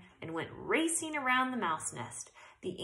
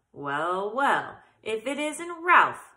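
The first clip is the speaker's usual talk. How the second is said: hearty uncle greeting; 3 semitones higher, 8 dB louder, slower than usual. A narrator's reading. Uncle greets Ralph loudly.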